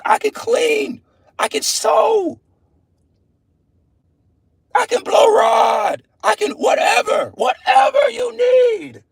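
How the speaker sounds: high, shouted, drawn-out pleading in a mocking woman's voice; mock begging woman. Preacher mocks women begging men, in a high shouted pleading voice. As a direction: ham up a desperate woman listing everything she can do. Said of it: "these women gonna be begging men".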